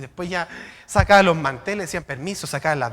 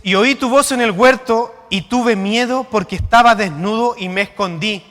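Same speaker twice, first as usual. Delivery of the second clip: louder, measured preaching read; scripture reading; 5 dB louder than usual. Acted. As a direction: declaim the verse to the congregation. Reads the verse again.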